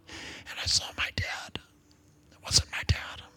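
soft, voiceless whisper; grieving whisper. Real, not acted. Telling congregation of dead father, grieving whisper. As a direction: whisper it as a painful memory.

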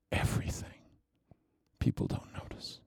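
drops to a whisper on the end; hushed. Acted. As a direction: let it fall to a breath. Secret known only to Jesus.

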